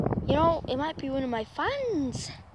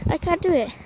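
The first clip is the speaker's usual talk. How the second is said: frustrated; 6 semitones higher, 4 dB louder than usual. Real, not acted. Struggling with the leash.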